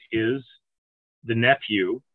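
ordinary delivery

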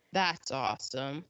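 low, soft deadpan sarcasm; sarcastic. Real, not acted. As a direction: drop low and quiet, dripping irony. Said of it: "that's sarcasm".

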